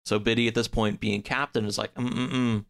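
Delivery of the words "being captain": ordinary talk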